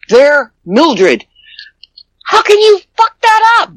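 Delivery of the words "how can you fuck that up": exasperated. Real, not acted. Exasperated about mixed-up mail.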